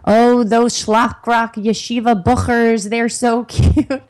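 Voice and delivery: lightly imitating a Yiddish-accented mother; gushing old Yiddish accent, slow. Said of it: "this kind of old Yiddish accent".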